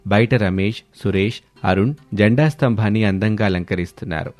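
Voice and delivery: plain reading voice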